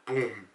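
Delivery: low, soft imitated drum thud; drum boom. Describing the drum heard in the scene, low boom. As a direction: hum a deep, muted boom. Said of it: "like a, like a drum".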